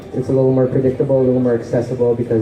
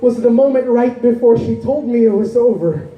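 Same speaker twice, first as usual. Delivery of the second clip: higher-pitched, urgent poetry recital; impassioned spoken-word poem; 10 semitones higher than usual. Acted. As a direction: pour out the heartbreak as slam poetry. Poem at its emotional height.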